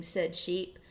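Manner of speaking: ordinary delivery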